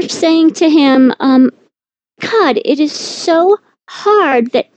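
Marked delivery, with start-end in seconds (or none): 2.2-4.7 higher-pitched, mildly anguished complaint; lightly acted wife's lament, pitched up; retold exasperated plea. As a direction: retell an exasperated appeal to a husband. Retelling her plea to husband.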